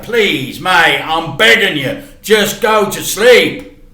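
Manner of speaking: a dad pleading with his son before the aunt arrives. Acted. Dad begs son to sleep.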